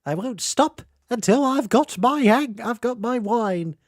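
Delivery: high, loud, stubborn auntie wailing for her wine; demanding auntie. Retold or imitated. Friend impersonating her auntie, high and loud. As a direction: whine it like a tipsy aunt who won't be stopped. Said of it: "Sounds like an auntie of mine".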